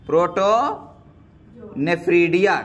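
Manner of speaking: Protonephridia stretched out slowly, syllable by syllable; drawn out slowly. Shown as a demonstration. Teacher drilling the term protonephridia for students, said slowly and drawn out. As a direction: spell out protonephridia slowly so the class can repeat it. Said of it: "क्या नाम दे सकते हैं?".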